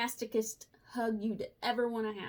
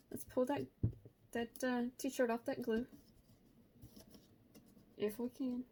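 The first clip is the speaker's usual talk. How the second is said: normal talking voice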